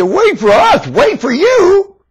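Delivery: very high, slow begging whine; mocking whine. Preacher mimicking the pleading world, a slow high whine. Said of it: "You say".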